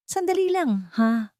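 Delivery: startled high wait; disbelieving. Acted. Doctor stares at the screen, high and disbelieving. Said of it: "tila hindi makapaniwala sa nakikita".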